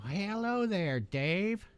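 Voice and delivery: high, slow sing-out hello; stretched hello, lifted in pitch; drawn-out greeting. Real, not acted. Greeting friend joining voice chat.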